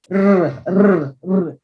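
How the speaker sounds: trilled r r r shown as the wrong way; rolled r to avoid. Shown as a demonstration. Teacher showing students the wrong rolled r sound. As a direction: roll the tongue on "r" as a mistake. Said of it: "Try to avoid this".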